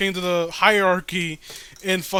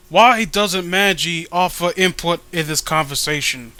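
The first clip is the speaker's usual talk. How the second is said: ordinary talk, nothing marked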